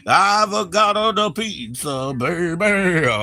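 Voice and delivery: drawn-out mock Italian accent with a big high lilt; Italian pizza guy. To the stream, playing the Italian pizza guy in a hammy Italian accent. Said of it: "Italian pizza guy".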